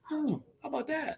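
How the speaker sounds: high, soft mock amazement; mock surprise. To the barman, high mock surprise. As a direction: act amazed at the free beer. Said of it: "I'm like".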